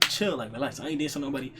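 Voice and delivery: low and soft, telling her to chill; soft calming. Retold or imitated. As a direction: hush her gently. Calming down the girl.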